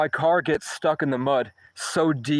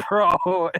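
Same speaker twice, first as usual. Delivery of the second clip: amused disbelief; 6 semitones higher than usual. Retold or imitated. Kid sees the ball of ice.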